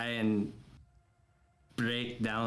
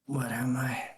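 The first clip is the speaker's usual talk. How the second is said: whisper; 2 semitones higher than usual. Real, not acted. Player whispers confused question.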